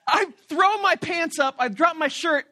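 ordinary delivery

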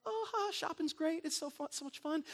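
high, airy mock chatter of two shoppers; a breezy high parody of women gabbing; chatty shoppers. Preacher mimicking women chatting at the mall. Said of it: "they're just talking".

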